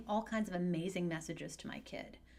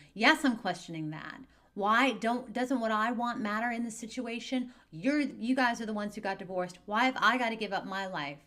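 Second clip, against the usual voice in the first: put-on loud, higher teen outburst; angry teen; 4 semitones higher, 7 dB louder than usual. Teen venting at divorced parent, loud and angry. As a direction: vent like a kid who feels the divorce was unfair.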